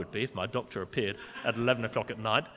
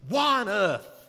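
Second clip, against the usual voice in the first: a loud, higher, indignant "why on earth"; 7 semitones higher, 7 dB louder, slower than usual. Retold or imitated. Religious leaders questioning Jesus, indignant and loud. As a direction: voice the critics' outraged question. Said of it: "It's indignant".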